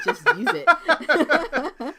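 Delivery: words stretched through laughter; laughing. Real, not acted. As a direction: laugh it out slowly. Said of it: "laughing through a joke".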